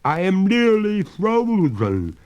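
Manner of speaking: a ghost lady stiffening with cold, pleading; high drawn-out phantom moan of cold; freezing ghost. A narrator's reading. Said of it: "showed signs of distress".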